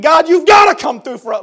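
high, loud acted plea; urgent pleading shout; desperate cry. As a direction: shout it as a desperate demand to God. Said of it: "They were desperate".

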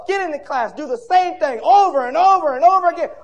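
high, loud, rhythmic preacher build to a shout; full-force preaching. Real, not acted. Preacher listing the church's week to congregation, full-force preaching. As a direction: drive through the list, climbing to a shouted peak.

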